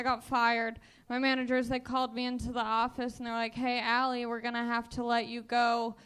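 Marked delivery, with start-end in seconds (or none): none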